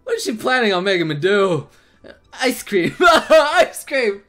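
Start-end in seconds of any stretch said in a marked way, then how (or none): 3.0-4.2 laughing, higher and louder; amused repeat. Real, not acted. Reacting to her ice cream demand, amused laughing repeat.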